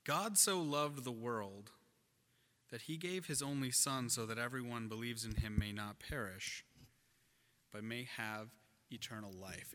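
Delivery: preacher reading scripture in a hushed voice; solemn scripture reading. Acted. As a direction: read it softly, with reverence.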